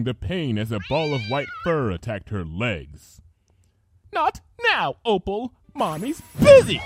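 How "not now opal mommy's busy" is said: high, loud, dismissive snap; impatient villain. Acted. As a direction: brush the cat off sharply. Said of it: "she said".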